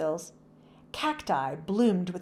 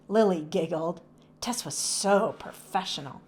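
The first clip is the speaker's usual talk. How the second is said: ordinary delivery, nothing marked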